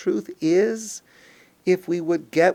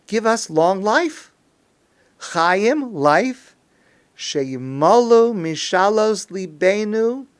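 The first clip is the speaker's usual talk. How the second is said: earnest, raised prayer, drawn out in places; sincere pleading; 4 semitones higher, 4 dB louder, slower than usual. Acted. Worshippers asking God to fulfill their wishes, pleading sincerely. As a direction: press the request on God with feeling. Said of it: "We're being sincere".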